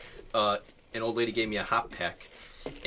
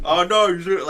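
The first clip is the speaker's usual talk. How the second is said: louder, higher urgent "Oh no"; 7 semitones higher, 9 dB louder than usual. Retold or imitated. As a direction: blurt it out loudly. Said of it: "like, a loud voice".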